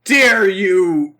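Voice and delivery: loud, stretched indignant cry; hammed-up fury, dragging out the words; mock outraged shout. Recapper plays Kaiba scolding Pegasus, mock outraged shout.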